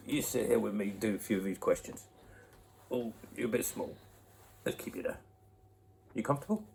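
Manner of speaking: gentle, hushed, settling a little one. Real, not acted. Settling baby on lap.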